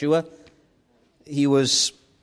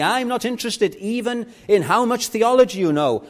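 high, urgent pulpit challenge; full-force preaching; 6 semitones higher, 6 dB louder than usual. Real, not acted. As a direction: press the congregation hard, rising to a loud climax.

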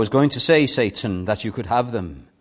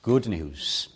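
tender invitation. Acted. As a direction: draw them in gently, almost whispering. Quiet preaching close.

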